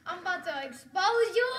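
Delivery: loud, teasing announcement; gleeful loud taunt; playful threat. Real, not acted. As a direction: punch it out loudly with a laugh.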